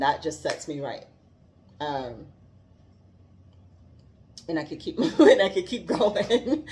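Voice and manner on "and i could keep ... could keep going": laughing through the words, louder. Real, not acted. Sharing her joy with viewers, laughing. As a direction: giggle it out as it bubbles up.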